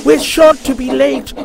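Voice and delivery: high, loud frantic engine; frantic panting. A narrator's reading. Peter Sam panting to catch Henry. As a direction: pant it out in a panic. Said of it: "panted Peter Sam frantically".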